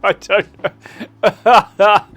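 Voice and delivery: laughing in character. Acted. Homeowner baffled by the guests, laughing. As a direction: laugh through the question.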